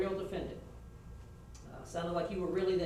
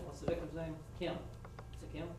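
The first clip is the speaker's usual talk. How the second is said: ordinary delivery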